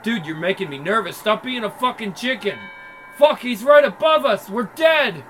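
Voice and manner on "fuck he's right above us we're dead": loud, high panicked cry; terrified. Acted. Gang member realizing the killer is above, terrified shout. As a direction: yelp it as doom lands on you.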